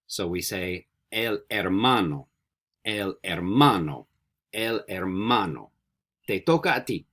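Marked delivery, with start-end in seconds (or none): 1.1-5.7 slow "el hermano" with no H sound, er-MA-no; silent H. Shown as a demonstration. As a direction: model "hermano" slowly, starting on the vowel. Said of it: "Don't pronounce the H".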